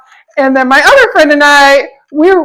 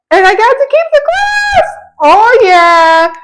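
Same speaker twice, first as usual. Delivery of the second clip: very high sing-out of delight; gleeful excitement; 9 semitones higher, slower than usual. Real, not acted. Vlogger showing off the glass they got to keep, gleeful. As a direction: crow about a prize, pitched way up.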